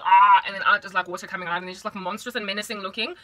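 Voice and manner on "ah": loud open-mouthed monster cry; gargoyle "ah". Acted. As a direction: blurt a loud "ah" like a gargoyle statue. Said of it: "And they're like".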